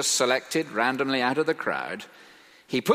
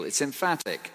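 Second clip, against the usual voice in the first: normal talking voice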